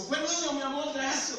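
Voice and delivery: a hint of the new mother's voice; lightly voiced wife. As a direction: lift it into her delighted thanks. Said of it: "y dice".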